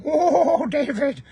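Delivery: put-on high, giggly Indian accent; Indian accent impression. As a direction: imitate the guru's delighted Indian accent. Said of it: "He'd start out with that Indian accent".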